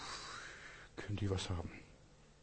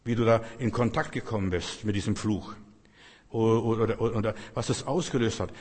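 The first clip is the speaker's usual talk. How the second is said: ordinary talk, nothing marked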